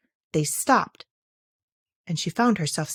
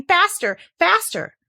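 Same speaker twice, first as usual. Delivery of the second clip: high, louder urging call; urgent cry; 10 semitones higher, 4 dB louder, slower than usual. Acted. The Queen drags Alice along, crying out. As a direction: hurry her on with each word. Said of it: "the queen kept crying".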